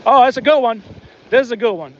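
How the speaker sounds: ordinary talk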